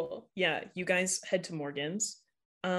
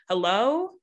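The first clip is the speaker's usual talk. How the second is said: mom calling out; 4 semitones higher, 7 dB louder than usual. Acted. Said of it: "your mom calls out to you".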